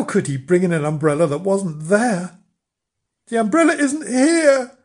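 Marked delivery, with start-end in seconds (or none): 3.3-4.8 high, loud wail from afar; raised, high-pitched anxious call. A narrator's reading. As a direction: call back high and plaintive. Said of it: "called Mister Flange".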